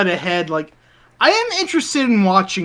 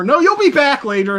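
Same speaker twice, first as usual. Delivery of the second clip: hammy cartoon reassurance, pitched up; dramatic cartoon reassurance; 8 semitones higher, 4 dB louder than usual. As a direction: play the hero promising a return. Acting out the cartoon scene.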